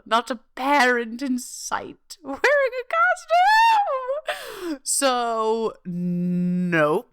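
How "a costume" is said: "a costume" stretched very slow and much higher; dragged-out outrage. Acted. Telling listeners no parents dressed up, "a costume" drawn out high and slow. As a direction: pull "a costume" out long and high in mock disbelief.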